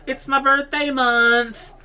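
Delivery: mocking a girl who claims a birthday month, high and slow; valley girl impression. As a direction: play the entitled girl bragging.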